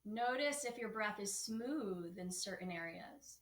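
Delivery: slow, soft yoga teacher guidance with long pauses; calm, measured breathing-practice voice; guided meditation. Acted. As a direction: lead them gently into the breath, unhurried.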